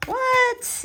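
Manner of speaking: sudden high squeak of surprise; a shrill startled What?; surprised what. Real, not acted. Reader startled by the card, squeaking a high What. Reacting to card's message.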